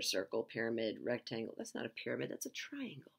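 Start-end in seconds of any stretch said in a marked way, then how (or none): 1.6-3.1 softly whispered self-correction; whispered aside. Real, not acted. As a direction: whisper it to yourself. That's not a pyramid.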